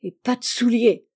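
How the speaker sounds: mildly acted tearful protest; a touch of frustrated, weepy anger; tearful rage. A narrator's reading. Boy left without shoes, understated tearful rage. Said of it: "disait Tony en pleurant de rage".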